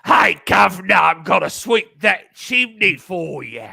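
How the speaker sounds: hammed-up Cockney chimney sweep, high, loud and slow; Tom Latimer Cockney. Co-host mimicking a British wrestling trainer, hammed-up Cockney accent. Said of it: "here's my best Tom Latimer".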